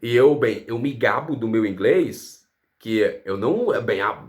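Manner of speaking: ordinary delivery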